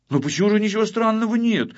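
high, quick, puzzled question; surprised objection. A narrator's reading. Pachkulya doubts his friend's dismissal, surprised. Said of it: "удивился Пачкуля".

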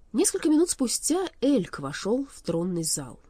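usual speaking voice

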